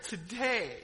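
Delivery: ordinary delivery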